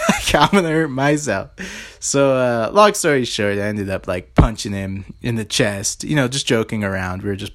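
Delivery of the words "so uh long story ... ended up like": normal talking voice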